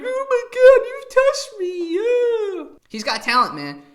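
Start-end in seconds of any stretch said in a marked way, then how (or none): 0.0-2.6 much higher and louder mock gushing, drawn out; gushing panelist. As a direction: ham up an overly moved woman, stretching the words. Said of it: "Now she's like".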